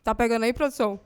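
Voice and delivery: talking with a mouthful of chips; mouth full. Real, not acted. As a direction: speak around a bite of food. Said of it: "não pode falar de boca cheia".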